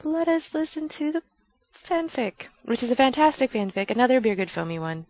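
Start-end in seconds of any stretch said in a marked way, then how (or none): 0.0-2.5 pitched-up mock plea to stop; playful higher-pitched mock complaint; impatient listener. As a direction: play a listener begging her to stop talking, voice raised high. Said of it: "and you're like".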